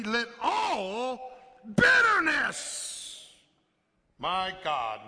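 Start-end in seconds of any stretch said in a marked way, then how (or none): 0.0-2.9 slow, loud proclamation; long, booming "Let all bitterness"; shouted verse. Acted. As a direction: bellow the scripture out.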